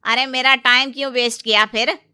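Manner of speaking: loud, irritated vendor complaint; annoyed seller. Acted. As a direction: complain loudly at the time-wasting customers. Seller annoyed at wasted time.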